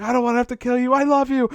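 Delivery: high, rising melodramatic plea; mock anguished declaration. Podcaster acts out trailer standoff, mock overwrought. Acting out film's standoff scene.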